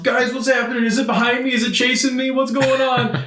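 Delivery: high-pitched frantic calling out to teammates; imitated panicked yell. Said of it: "like, yelling".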